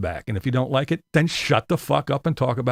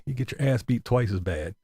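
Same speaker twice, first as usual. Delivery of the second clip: usual speaking voice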